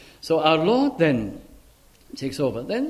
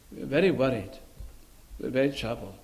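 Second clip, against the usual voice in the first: low, soft, grieving voice; hushed sorrow; 5 semitones lower, 7 dB quieter, much faster than usual. Acted. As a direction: sigh it out as a troubled disciple.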